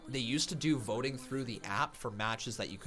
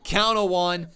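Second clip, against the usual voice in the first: high, loud wrestling commentator; excited play-by-play; 9 semitones higher, 8 dB louder than usual. Real, not acted. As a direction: shout the call like a ringside announcer. Live wrestling play-by-play call.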